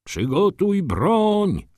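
high, drawn-out order to the ranks; slow, high-pitched parade-ground call; military command call. A narrator's reading. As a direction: call the order down the line, stretching the vowels. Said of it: "rozległo się po szeregach".

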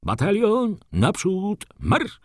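high, drawn-out major's marching order; high-pitched parade-ground cry; bellowed command. A narrator's reading. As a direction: call out to a whole battalion, stretching each word. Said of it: "wrzasnął ogromnym głosem nasz major".

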